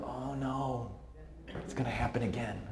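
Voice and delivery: a quiet sigh of dread; inner dread. Retold or imitated. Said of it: "I heard them like".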